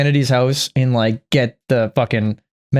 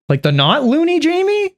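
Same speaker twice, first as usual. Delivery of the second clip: much higher, scoffing question; mocking. Real, not acted. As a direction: ridicule the idea. Mocking Jamie's loony label.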